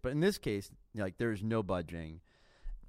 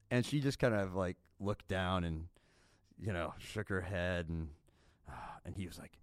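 ordinary talk

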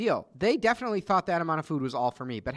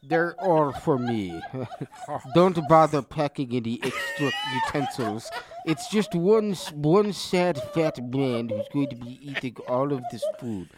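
slow, heavy sad fat man voice; fat guy voice; 2 semitones lower than usual. Acted. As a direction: drawl it out like a sad glutton. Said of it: "the fat guy voice".

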